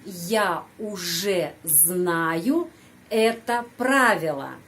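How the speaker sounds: very slow, careful model sentence; slowly. Shown as a demonstration. Teacher says it slowly so students can follow. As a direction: lay each word out slowly for students to repeat. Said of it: "Pelan-pelan, ya, pengucapannya ikut saya".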